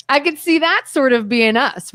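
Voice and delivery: ordinary talk, nothing marked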